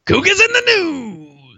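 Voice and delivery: high, drawn-out echo of a title; hammed-up segment announcer. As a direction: stretch it out high like a jingle.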